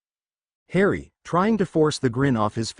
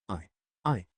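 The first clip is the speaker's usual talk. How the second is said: soft, choked, close to tears; a quiet tearful stammer; 5 semitones lower, 9 dB quieter than usual. A narrator's reading. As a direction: falter quietly as tears come. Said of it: "Tears began to well up in her eyes".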